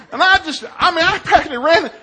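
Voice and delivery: ordinary talk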